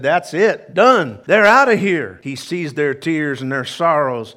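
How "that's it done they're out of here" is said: emphatic declaration. Acted. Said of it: "He said".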